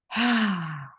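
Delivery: long audible breath-out sigh; exhale sigh. Shown as a demonstration. Coach breathing out with the viewers, a long audible sigh. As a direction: let all the air go in one big sigh. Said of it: "and let it all out".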